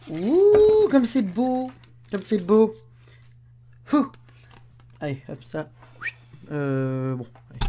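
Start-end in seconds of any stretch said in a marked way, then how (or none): 0.1-4.1 squealing high with delight; delighted wow. Real, not acted. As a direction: marvel at it, voice soaring. Émerveillement devant le mug. (émerveillement aigu et ravi)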